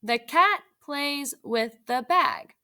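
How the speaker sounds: put-on slow, word by word for young readers; slow read-aloud. Shown as a demonstration. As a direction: read it out slowly so kids can follow. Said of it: "Now you read it".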